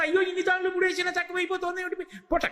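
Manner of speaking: high, fast exasperated exclamation; exasperated cry. Retold or imitated. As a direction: throw up your hands in disbelief, pitched high. Mother baffled, rope falls short.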